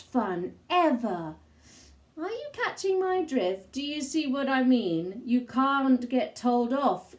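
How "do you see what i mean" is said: plain reading voice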